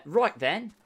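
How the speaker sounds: chirpy Cockney "Right then"; mock Cockney voice; Cockney accent. Shown as a demonstration. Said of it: "English accent ... more Cockney".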